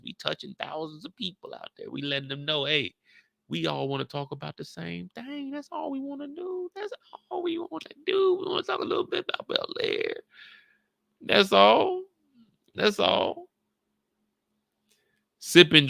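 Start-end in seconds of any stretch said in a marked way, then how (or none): 2.6-13.5 high, hushed put-on voice; hammed-up gentle pitch, much higher. Speaking to guests in a mock gentle high voice.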